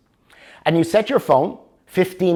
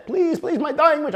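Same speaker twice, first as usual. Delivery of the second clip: high, whiny pleading with a laugh; begging husband; 13 semitones higher than usual. Acted. Dying husband begging his wife in a high whiny plea. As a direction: beg like a pitiful old man.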